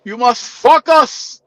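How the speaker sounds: slow high mock Greek accent; heavy Greek accent. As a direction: needle her with the accent. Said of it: "that heavy ass accent".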